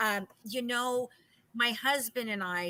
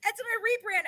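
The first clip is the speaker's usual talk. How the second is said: "That's not a rebrand" echoed much higher with a falling end; 13 semitones higher, 3 dB louder, faster than usual. Real, not acted. Cohost echoing host, "That's not a rebrand" pitched high in disbelief. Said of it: "cohost echoes, far higher".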